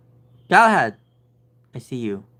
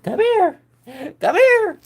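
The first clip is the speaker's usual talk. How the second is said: very high, loud call; calling the cat; 7 dB louder, slower than usual. Real, not acted. Owner calling her cat to come, very high and loud. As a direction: shout him over.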